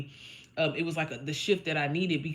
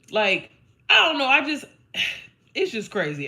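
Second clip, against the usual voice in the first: choked up; 6 semitones higher, 7 dB louder than usual. Real, not acted. Overcome thinking about home.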